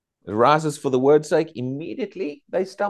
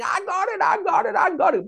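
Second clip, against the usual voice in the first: imitating a churchgoer's ecstatic shouts; mock excited screaming. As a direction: play the overjoyed churchgoer running round shouting.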